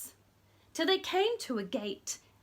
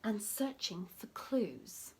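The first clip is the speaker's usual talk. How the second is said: whisper; 4 semitones lower, 7 dB quieter than usual. Acted.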